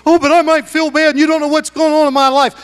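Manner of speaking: petulant excuse voice; mock excuse. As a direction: imitate a sulking churchgoer. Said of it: "You say".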